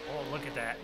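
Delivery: ordinary delivery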